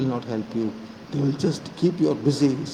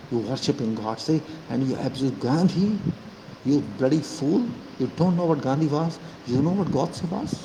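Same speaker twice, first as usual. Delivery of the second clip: ordinary talk, nothing marked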